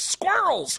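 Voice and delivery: put-on high huffy child; mock pouting daughter; angry little girl. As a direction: spit it out like a cross kid. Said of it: "She goes".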